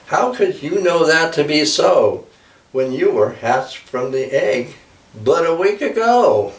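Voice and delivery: amazed, pitch lifted high; surprised mother eagle. Acted.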